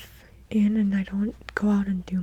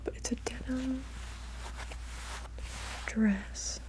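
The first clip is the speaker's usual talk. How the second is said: close, unvoiced whispered chat; ASMR whisper; 5 dB quieter than usual. Real, not acted. ASMR creator chatting to viewers in a whisper. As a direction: whisper intimately into the mic.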